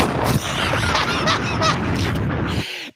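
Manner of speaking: long, high helpless laugh; high breathless laughing fit; cracking up. Real, not acted. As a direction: burst out laughing. Cracks up at the reply.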